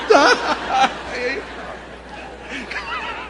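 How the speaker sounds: loud, high burst of laughter; hearty laugh. Real, not acted. Preacher amused by his conversion story, real laughing. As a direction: laugh out at your own story.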